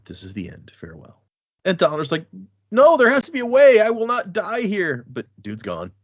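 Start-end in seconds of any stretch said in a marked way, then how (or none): 0.0-1.2 trailing off into a whisper as he leaves; fading whisper. Acted. God fades away departing. 2.7-5.0 loud and high, refusing to accept it; desperate cry. Acted. Dalinar pleads as god leaves.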